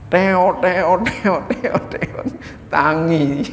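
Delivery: playful sing-song nudge to wake up; mock waking call. Preacher mimics waking a sleepy husband, sing-song. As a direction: call them awake, teasing. Waking someone for dawn prayer.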